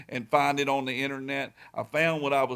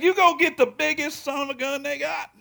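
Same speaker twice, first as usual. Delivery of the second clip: much higher, a touch louder, enthusiastic; wife's hearty yes; 10 semitones higher, 3 dB louder than usual. Retold or imitated. Wife tells her husband to buy the biggest bike, enthusiastic and higher. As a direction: give the go-ahead with gusto. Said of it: "She said".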